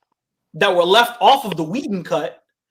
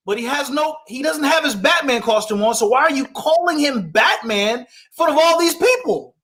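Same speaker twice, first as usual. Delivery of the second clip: normal talking voice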